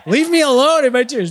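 loud, hammed-up wounded protest; mock protest. Husband caught crying tells off wife, mock-upset and loud. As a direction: protest loudly like a wounded husband, played for laughs.

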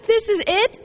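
high-pitched mock disbelief; incredulous dismay. Let-down believer questioning God about destiny, incredulous dismay.

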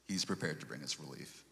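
ordinary talk

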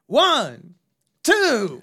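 loud, high count for attention; big booming mock count, drawn out; mimicked dad counting out loud. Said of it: "his reaction was just to go".

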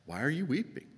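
a touch of detached questioning; mildly aloof Jesus. Acted. Aloof Jesus asks Mary why she weeps, mildly detached. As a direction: ask lightly, without sympathy. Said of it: "John has this aloof Jesus who says".

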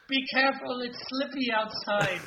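high, comic northern English warning; northern English accent. Acted. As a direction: warn in a put-on northern English voice. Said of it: "more like a northern English accent".

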